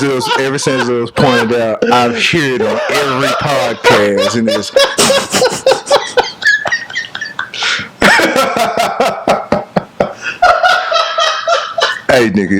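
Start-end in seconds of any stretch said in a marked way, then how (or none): 4.8-12.0 long high wheezing snicker; cartoon cat laugh. Real, not acted. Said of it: "it's not that funny".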